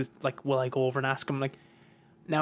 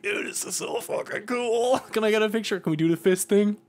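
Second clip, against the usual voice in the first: starstruck fan; 6 semitones higher than usual. Retold or imitated. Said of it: "And I was just like".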